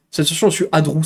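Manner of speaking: usual speaking voice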